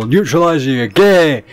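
loud, jokey yell at his mate; playful loud jab at a friend; teasing call-out. Real, not acted. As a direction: shout the taunt across the room.

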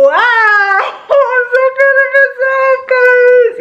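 high squeal of joy, half laughing, half sung; real happy tears, squealing; overjoyed cry. Real, not acted. Bursting with joy on camera.

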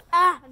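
sudden loud scream; startled scream. Real, not acted. Startled when the dog's face hits hers, loud scream. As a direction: shriek in surprise. That's terrifying.

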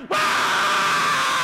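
loud, low, raw scream of falling; terrified scream. Retold or imitated. Falling off the plank, a loud scream. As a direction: scream as the world rushes at you.

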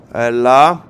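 loud, excited "έλα"; eager urging. Real, not acted. Urging the last shot to land his kill, loud. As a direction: push for the final shot.

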